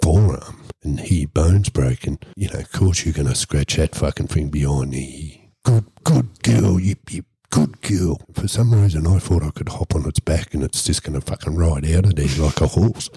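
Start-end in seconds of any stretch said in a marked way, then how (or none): 5.6-8.2 slow, high-pitched coo easing down; pet praise. Retold or imitated. Storyteller scratching the panda behind the ear, cooing praise.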